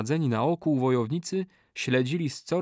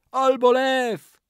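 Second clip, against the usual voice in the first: very high, slow, loud wail; ritual curse; 13 semitones higher, 6 dB louder, much slower than usual. A narrator's reading. Old man calls a lion on betrayer, high wail. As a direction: wail the incantation.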